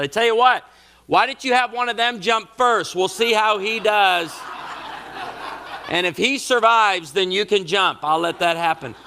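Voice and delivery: high, loud, joking dad voice; mock bargain. Dad bargaining with his daughter on the bluff. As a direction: bargain with her, playing it up. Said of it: "I said".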